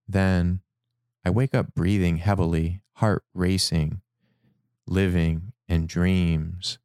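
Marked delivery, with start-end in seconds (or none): none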